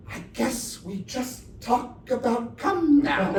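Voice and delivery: ordinary talk, nothing marked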